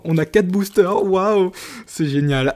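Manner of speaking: thrilled unboxing gasp of joy; excited. Real, not acted. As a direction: gush over the four boosters, slowly.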